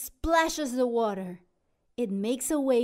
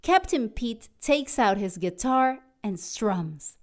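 usual speaking voice

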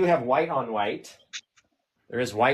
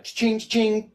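cha-ching; 8 semitones higher than usual. Pretend. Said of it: "imitating a till sound".

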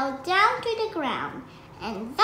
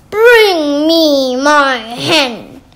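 slow, much louder roar; giant roaring; 13 dB louder, slower than usual. Acted. Greedy giant roaring at the cook for his hen. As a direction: bellow it out slowly. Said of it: "roared the greedy giant".

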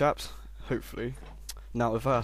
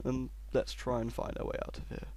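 usual speaking voice

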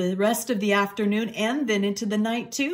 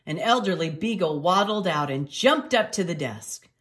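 usual speaking voice

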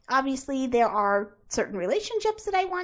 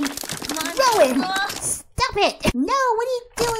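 raised, higher shout at Rowan; calling out; 7 semitones higher, 4 dB louder, slower than usual. Real, not acted. Player yelling at Rowan to stop smashing, loud and high. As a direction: stop him mid-smash.

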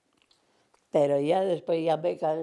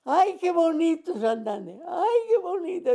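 high, louder delighted exclaiming; 12 semitones higher, 3 dB louder than usual. Retold or imitated. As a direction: gush over the view like the old lady. The lady admiring Santander, voiced.

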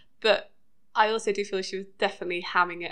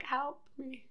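feeble plea; 4 semitones higher, 11 dB quieter than usual. Retold or imitated. Dean's hand pleading from ground.

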